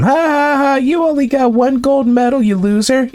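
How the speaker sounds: high-pitched mock gloating; mocking laughter. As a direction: jeer like a playground bully, hammed up. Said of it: "Let's laugh at him".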